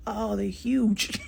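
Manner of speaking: slow, drawn-out amazement; mock awe. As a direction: marvel at their size, stretching it out. Reacting to huge ancestors.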